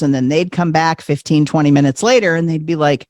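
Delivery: ordinary delivery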